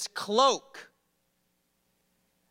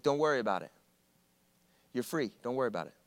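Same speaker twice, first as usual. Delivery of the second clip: lower, soft, kind professor; softly voiced kind professor; gentle pardon; 8 semitones lower, 7 dB quieter, much faster than usual. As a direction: forgive him gently. Professor reassures.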